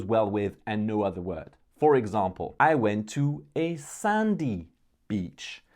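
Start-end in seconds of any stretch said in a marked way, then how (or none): 2.6-5.6 "sandy" raised high and drawn out, then a pause before "beach"; slow down and lift "sandy", let "beach" drop; stress on sandy. Shown as a demonstration. Speaker showing learners the helpful adjective, stress on "sandy".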